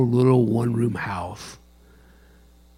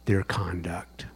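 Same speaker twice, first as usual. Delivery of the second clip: whisper; 5 semitones lower, 9 dB quieter than usual. Real, not acted. Pastor landing his point to congregation, whispered. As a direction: breathe the last words.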